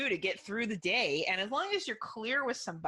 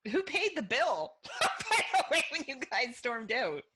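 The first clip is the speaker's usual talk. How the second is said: raised pitch, breaking into laughter; laughing question; 4 semitones higher than usual. Real, not acted. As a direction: tease him through real laughter. Cracking up at his story.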